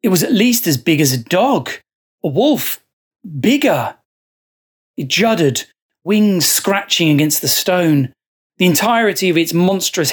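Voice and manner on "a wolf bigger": ordinary delivery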